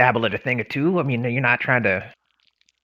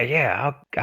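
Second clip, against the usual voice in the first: usual speaking voice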